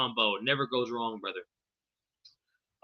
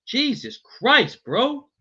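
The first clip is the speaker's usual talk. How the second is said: slow stunned awe; 9 semitones higher, 4 dB louder, much slower than usual. Real, not acted. Reacting to the highlight reel.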